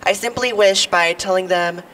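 ordinary talk

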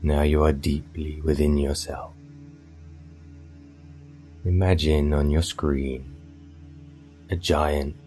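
normal talking voice

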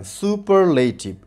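সুপারলেটিভ slowly, in four parts su-par-lei-tiv; syllable by syllable. Shown as a demonstration. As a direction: break সুপারলেটিভ into slow, even syllables. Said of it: "শব্দটিকে চারবারে উচ্চারণ করতে হয়". (সুপারলেটিভ চার ভাগে ধীরে ধীরে)

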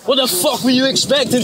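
ordinary talk

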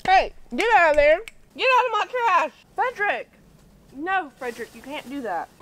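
loud, high yell at an animal. Real, not acted. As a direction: yell at the pest. Armadillo in trash.

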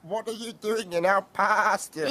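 lamb voice. Acted. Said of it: "bleated some lambs".

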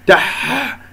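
تح ending in a long scraped ح; rasped haa. Shown as a demonstration. Teacher mimics faulty readers, تح with rasped haa. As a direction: drag the breath hard through the ح of تح. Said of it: "هكذا يجعل فيها شحطة".

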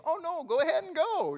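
breezy let-off; cop waving off. Retold or imitated. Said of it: "And then he goes".

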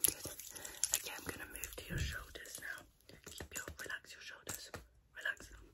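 soft unvoiced ASMR whisper. ASMR whisper during massage.

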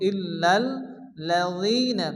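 slow ইল্লাল্লাযীনা, both doubled l sounds held; ইল্লাল্লাযীনা drawn out with firm double l. Shown as a demonstration. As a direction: lean on each ll in ইল্লাল্লাযীনা. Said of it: "double double উচ্চারণ করব".

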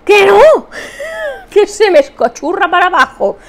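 high loud no; playful protest. Real, not acted. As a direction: shout it laughing. Refusing mascara question.

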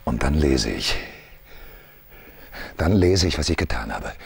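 low, hushed confession; hushed tormented man. Acted. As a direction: recall it with dread. Continues the tormented monologue.

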